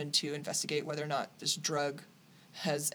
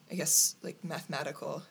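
ordinary delivery

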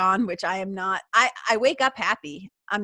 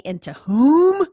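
"and to whom" slow, much louder and higher; emphatic; 6 semitones higher, 12 dB louder, slower than usual. Real, not acted. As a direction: land the last item of the list hard. Climax of a list.

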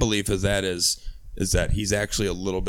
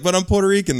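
pleading; 10 semitones higher, 4 dB louder, faster than usual. Pretend. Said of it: "I'm like".

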